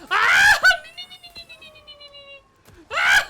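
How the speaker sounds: high shrieks breaking into laughter; helpless loud squealing giggles; screaming laughter. Real, not acted. Startled while playing the game.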